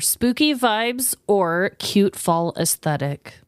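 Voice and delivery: usual speaking voice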